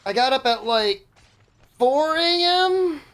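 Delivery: drawn-out high disbelief; incredulous. Real, not acted. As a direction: stretch it out, stunned. Disbelief at waking so early.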